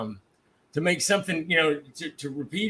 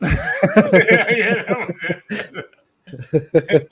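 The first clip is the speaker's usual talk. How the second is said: loud, breaking up with laughter; laughing; 2 semitones higher, 6 dB louder, much slower than usual. Real, not acted. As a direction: talk through a real laughing fit. Cracking up at the joke's punchline.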